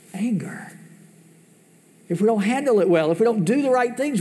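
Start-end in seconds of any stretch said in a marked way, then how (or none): 0.1-0.7 whispered single word; a hushed, whispered anger. Acted. Answering his own rhetorical question.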